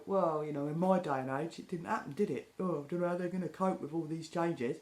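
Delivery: a hint of a moaning pensioner; older person. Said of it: "you hear older people go".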